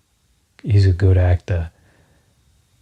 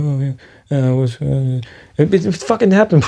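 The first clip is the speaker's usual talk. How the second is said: awkward admission; 6 semitones higher than usual. Retold or imitated. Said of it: "Just be like".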